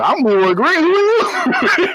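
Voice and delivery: high, long drawn-out "who are you"; hammed-up imitated dad; retold dad's challenge. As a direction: challenge the stranger, ending very high and stretched.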